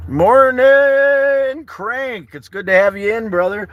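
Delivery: high, loud sing-song hello; light up and call out a big good morning; excited greeting. Real, not acted. Greeting Crank as he joins, high excited call.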